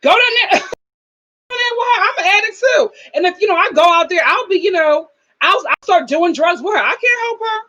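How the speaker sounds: hammed-up sister excuse; mocking the sister. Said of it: "the way she said".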